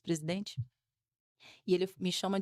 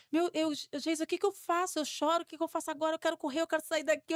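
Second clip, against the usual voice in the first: higher, fast, panicky pleading; desperate panic; 7 semitones higher, faster than usual. Retold or imitated. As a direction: blurt it out in a panic to Geisa. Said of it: "Eu fiquei desesperada". (desespero retratado, mais agudo e rápido)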